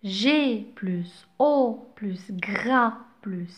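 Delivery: hushed sounding out; whispered syllable spelling. Shown as a demonstration. Teacher to pupils, whispering the syllables to blend. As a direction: whisper G plus O plus gras. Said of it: "teacher sounding out syllables".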